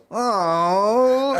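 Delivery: put-on high whining kid's groan; imitating a whiny kid. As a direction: whine like a kid told to go to bed. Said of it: "my girl's son goes".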